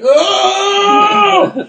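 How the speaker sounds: high loud strained yelp; a sudden pinched high cry of effort; hammed-up strained moan. His strained face during sex.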